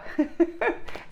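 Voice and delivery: soft unvoiced giggle; breathy laugh. Real, not acted. Laughing at her mirrored t-shirt, soft giggle. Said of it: "laughs at shirt".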